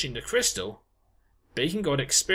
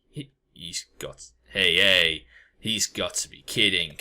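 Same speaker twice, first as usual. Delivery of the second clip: much lower and rough; deep crab sidekick in disbelief; low gruff disbelief; 9 semitones lower than usual. Acted. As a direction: scoff like a brash crab.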